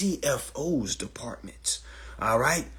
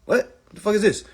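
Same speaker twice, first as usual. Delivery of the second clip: baffled outburst; 9 semitones higher than usual. Retold or imitated. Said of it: "I'm like".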